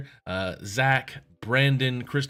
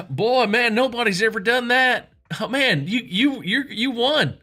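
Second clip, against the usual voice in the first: much higher, louder amazed Texan voice; excited rich Texan; 11 semitones higher, 7 dB louder than usual. Retold or imitated. Rich Texan congratulates the swimmer, high and amazed. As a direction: gush like a stunned rich host.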